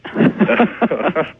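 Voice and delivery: high burst of real laughter; amused laugh. Real, not acted. Ghost investigator reacts to host's doubt, amused laugh. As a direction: laugh at the wry question.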